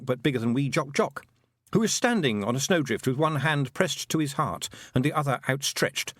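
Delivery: usual speaking voice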